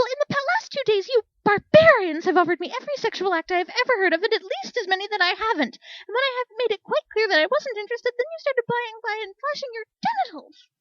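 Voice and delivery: high, loud furious tirade; furious rant. Acted. Speck ranting about pushy soldiers, high furious tirade. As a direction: let the outrage pour out.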